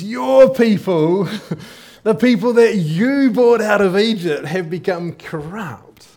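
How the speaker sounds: slow, high, loud divine rebuke; angry God. Acted. Preacher voicing God angry at Moses. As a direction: thunder the words at Moses. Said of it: "See, God is angry".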